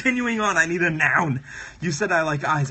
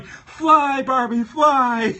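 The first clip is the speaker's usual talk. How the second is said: mock cheer; 5 semitones higher, 6 dB louder, much slower than usual. Cheering drawn Barbie on.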